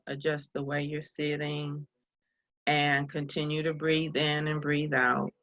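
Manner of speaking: unhurried meditation guide with long pauses; calm, spacious guiding voice; guided meditation. Real, not acted. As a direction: ease listeners into breathing, leaving space between phrases.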